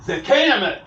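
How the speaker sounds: loud, high shouted retort; excited outburst. Retold or imitated. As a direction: shout it back, imitating an agitated man. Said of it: "He gets very excited".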